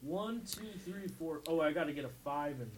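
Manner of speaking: barely audible, counting under breath; muttering to himself. Real, not acted. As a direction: mumble the numbers to yourself. [name] mutters betting numbers.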